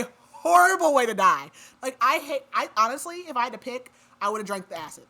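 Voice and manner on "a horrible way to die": horrible punched loud and higher; stress on horrible. Real, not acted. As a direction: hit horrible with real disgust. Disgust at gruesome death.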